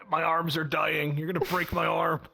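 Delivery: ordinary talk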